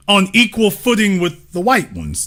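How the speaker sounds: normal talking voice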